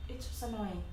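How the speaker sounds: ordinary delivery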